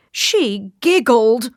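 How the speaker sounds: slow, outraged royal cry; scandalised king. A narrator's reading. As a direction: wail the accusation slowly. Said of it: "went on the king".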